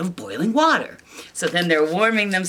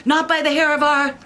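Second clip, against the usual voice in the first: higher, louder pig defiance; 9 semitones higher, 5 dB louder than usual. Acted. Pigs defying the wolf, high pitched. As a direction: answer back high like a pig. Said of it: "the three pigs say".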